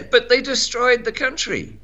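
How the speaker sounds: laughing through the words. Real, not acted. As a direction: laugh while you say it. Laughing at his own joke.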